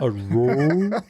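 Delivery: "drawer" stretched slow into one rising growly syllable; playful drawled "drawer". Pretend. Artist joking with co-host about being a drawer, playful drawled "drawer". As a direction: drag out "drawer" as a mushy rising roar.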